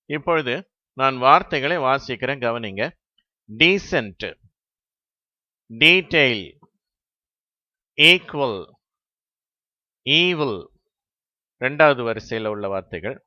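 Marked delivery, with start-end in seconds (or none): 3.5-10.6 டீசென்ட், டீடெயில், ஈக்குவல், ஈவில் each with a stretched "ee"; long first vowel. Shown as a demonstration. Teacher reads the word list, long-vowel demo. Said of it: "நெடில் ஓசையோட ஈ என்று".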